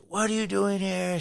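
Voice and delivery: high-pitched retold scolding; cussing out. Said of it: "She's cussing me out".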